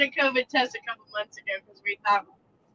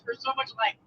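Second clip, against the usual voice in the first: usual speaking voice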